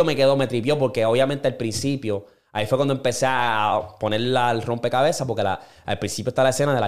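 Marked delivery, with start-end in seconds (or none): none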